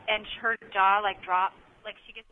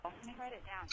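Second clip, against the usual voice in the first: whispering; 19 dB quieter than usual. Real, not acted. Aside while writing something down.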